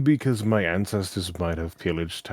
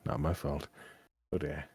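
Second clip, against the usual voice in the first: ordinary delivery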